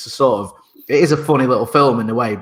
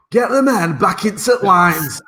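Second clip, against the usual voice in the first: put-on slow, higher Sheffield-accented battle command; Sean Bean Sheffield accent; 7 semitones higher, 3 dB louder, slower than usual. Mimicking Sean Bean's Sheffield accent as a Greek in Troy. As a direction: bark it like a Sheffield soldier in Troy, slowly. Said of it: "he's a Greek in Troy".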